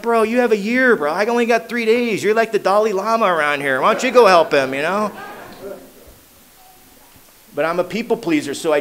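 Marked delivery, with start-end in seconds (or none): 0.0-5.1 higher-pitched, indignant pushback; whiny protest. Retold or imitated. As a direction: complain like a newcomer dodging the task. Arguing back at sponsor.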